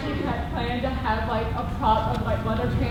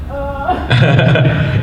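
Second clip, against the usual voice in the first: loud, caught-out uh with a laugh; stunned blank grunt; 2 semitones higher, 13 dB louder than usual. Retold or imitated. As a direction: freeze up, just a startled uh. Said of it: "And I'd just be like".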